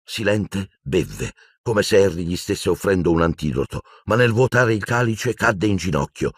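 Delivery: normal talking voice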